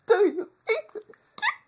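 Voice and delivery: high, loud crying wail; anguished wail. Pretend. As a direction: wail it in mock despair. Sudden wailing plea.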